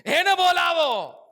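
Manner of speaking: high, loud, slowed call; Jesus calling out firmly; loud command. Acted. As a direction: order them to bring him.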